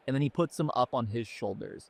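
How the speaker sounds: ordinary talk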